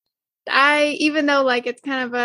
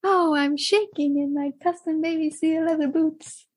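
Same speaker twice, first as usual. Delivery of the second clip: higher, put-on movie-character voice; Megamind; 4 semitones higher than usual. Co-host finishing a remembered film line, mock-scared Megamind impression. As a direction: quote it theatrically, pitched up with mock dread. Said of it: "that Megamind line".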